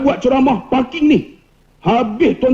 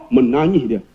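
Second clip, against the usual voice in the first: usual speaking voice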